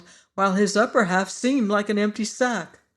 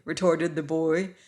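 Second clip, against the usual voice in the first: ordinary delivery, nothing marked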